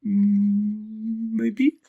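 high, unsure little elephant voice; not quite ready to commit; hesitant Bobo. Acted.

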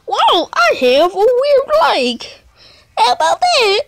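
hammed-up squeaky voice; silly high voice. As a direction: marvel like a cartoon. Goofy high voice bit.

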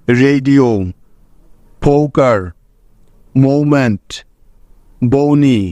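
each word said slowly and clearly, ending on a falling tune; word list demo. Shown as a demonstration. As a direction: model every o sound clearly, word by word. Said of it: "যেমন দেখো". (ধীরে স্পষ্ট উচ্চারণে শব্দ তালিকা)

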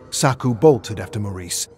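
ordinary delivery, nothing marked

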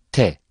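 T said thin and strong; crisp, thin T with a firm release; Arabic letter te. Shown as a demonstration. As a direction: sound the letter T thin and forceful. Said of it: "ince ve kuvvetlice telaffuz edilir".

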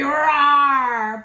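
loud growling roar like an angry lion. Acted. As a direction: roar it out like a cross lion for the kids.

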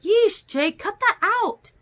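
high, sharp startled protest; startled scolding. A narrator's reading. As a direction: scold a friend who made you jump. Startled, scolds friend for scaring her.

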